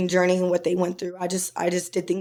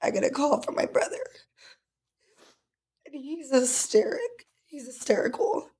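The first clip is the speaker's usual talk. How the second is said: higher, softer, choked tearful voice; crying; 7 semitones higher, 6 dB quieter than usual. Real, not acted. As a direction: struggle to speak through real tears. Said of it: "I'm crying now".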